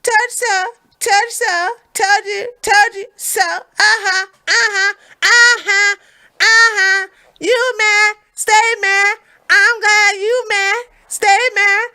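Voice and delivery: high, loud sing-song gloating; gloating taunt. Pretend. Vlogger gloats at subscribers who doubted him, loud and high. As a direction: rub it in like a playground taunt. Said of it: "and then I get to go".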